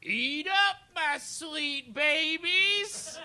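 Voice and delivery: high, slow Southern drawl from above; sweet booming Southern cook calling down; Paula Deen voice. Acted. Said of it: "Paula Deen-esque voice from above you".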